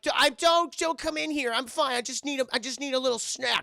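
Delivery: high-pitched stammering mock-girl voice; imitated girl covering up a binge; frantic stalling. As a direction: keep them out, hammed-up and flustered. Girl keeps date out while bingeing.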